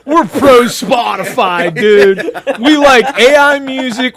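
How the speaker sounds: loud laughing fake rant; mock rant. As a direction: shout it like a joke manifesto.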